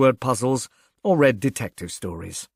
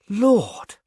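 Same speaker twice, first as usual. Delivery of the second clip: higher, stronger second Lord; shocked exclaim; 9 semitones higher than usual. A narrator's reading. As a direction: let the shock break through. Shocked at poisoning news.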